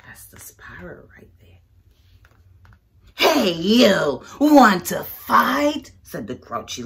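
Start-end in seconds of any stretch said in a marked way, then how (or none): 0.1-1.6 soft whispered pointer; whispered aside. Real, not acted. Aside while showing picture. 3.4-5.9 loud, slow drawn-out dare; the grouchy ladybug dares the yellow jacket; challenging shout. Acted.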